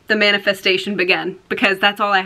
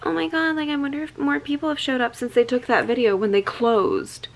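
high-pitched mock worrying to oneself; imitated fretting; 4 semitones higher than usual. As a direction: voice your own anxious late-night thought, pitched up. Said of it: "being like".

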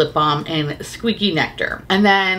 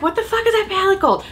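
startled outburst; 11 semitones higher, faster than usual. Retold or imitated. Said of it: "I was like".

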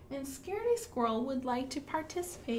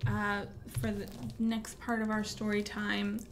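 ordinary delivery, nothing marked

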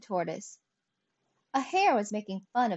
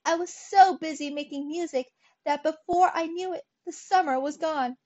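high, louder whiny excuse; whining; 7 semitones higher, 5 dB louder than usual. A narrator's reading. Grasshopper explaining his empty stores to ants, whining. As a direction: whine plaintively in a raised voice. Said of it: "whined the grasshopper".

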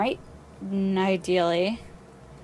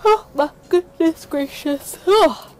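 talking through a big yawn, higher and louder; 8 semitones higher, 7 dB louder, slower than usual. Real, not acted. Tired player exclaiming mid-game, yawning. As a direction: let a real yawn stretch the words. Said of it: "I am tired".

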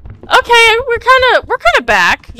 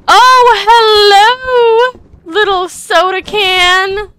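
put-on cooing baby talk; 8 semitones higher, 4 dB louder, much slower than usual. Cooing at an item.

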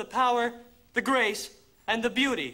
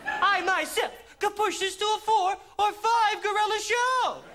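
hyping crowd; 11 semitones higher than usual. Acted. Hyping crowd like a rally host.

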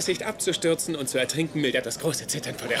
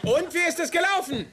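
calling out; 13 semitones higher than usual. Real, not acted. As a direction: shout the question through the door. Ruft zur Toilette hinüber. (ruft laut durch die Tür zur Mutter)

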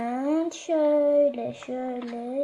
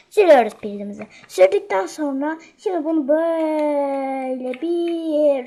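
louder, so the mic picks it up; speaking loudly; 9 dB louder than usual. Real, not acted. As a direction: raise the voice for the video viewers. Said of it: "sesli konuşuyorum".

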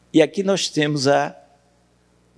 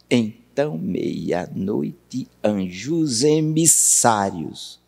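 slow, measured syllable count; syllable-by-syllable scansion; 4 semitones lower, 3 dB quieter, much slower than usual. Shown as a demonstration. Teacher recounting the verse to reach eleven, slow scansion. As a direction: stretch "Então, meia-noite, anjos emissários" syllable by syllable.

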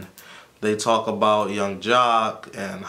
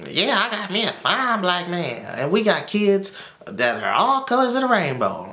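higher put-on voice, playing Lovely Mimi boasting; mocking Lovely Mimi; 8 semitones higher than usual. Recapping Lovely Mimi's brag to viewers, in a higher mocking voice. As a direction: mimic her bragging with a higher put-on voice. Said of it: "She's like, um".

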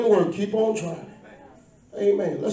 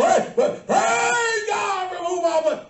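drawn-out preaching cry; 8 semitones higher, much slower than usual. Acted.

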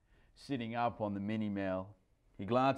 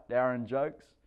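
ordinary delivery, nothing marked

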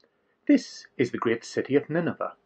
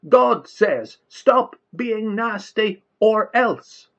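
higher, louder repeated warning; yell; 8 semitones higher, 6 dB louder, slower than usual. A narrator's reading. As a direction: hammer the threat home. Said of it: "yelled Jonah".

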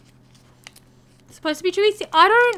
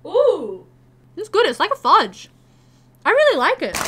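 delighted; 2 semitones higher, 7 dB louder than usual. Real, not acted. Delighted by candy.

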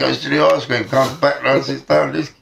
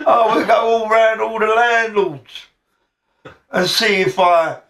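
slow, drawn-out higher voice; mimicked rival; 6 semitones higher than usual. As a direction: stretch it out, imitating the rival. Acted proposal from the rival.

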